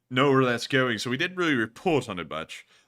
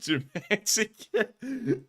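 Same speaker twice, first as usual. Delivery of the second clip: laughing through the words; 5 semitones higher, 4 dB louder, much slower than usual. Real, not acted. Streamer laughing at his slipping accents. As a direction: crack up at yourself mid-sentence.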